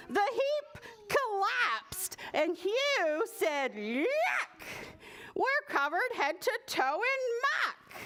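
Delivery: high, slowed excited telling; excited climax. A narrator's reading. Reading the big collapse to the child, high and excited. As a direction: build it up high and dramatic.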